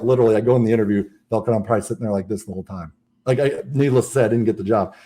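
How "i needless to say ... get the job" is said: ordinary talk, nothing marked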